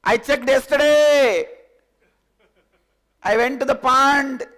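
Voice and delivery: loud, high, slow call. Acted. Lamb calls across to the lion, loud. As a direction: shout it across the distance.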